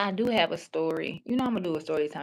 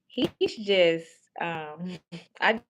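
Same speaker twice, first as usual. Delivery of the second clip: ordinary delivery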